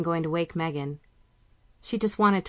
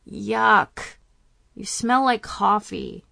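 put-on higher, disgusted teen girl voice; grossed-out teen; 5 semitones higher, 5 dB louder, much slower than usual. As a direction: wrinkle the nose and complain. Daughter grossed out.

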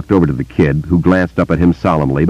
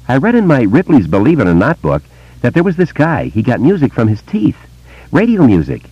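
put-on higher, eager boy's voice; young boy; 7 semitones higher than usual. A narrator's reading. Boy telling Bill about voices, in a higher boy's voice. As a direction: voice a wary small boy telling a secret.

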